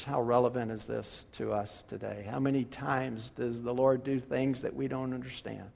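normal talking voice

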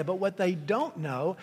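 ordinary talk, nothing marked

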